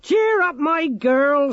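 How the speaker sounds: loud, high-pitched forced cheer; old granny's booming jollity; Granny Morky. A narrator's reading. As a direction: call it out high and hearty, trying to be friendly. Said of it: "trying to be friendly".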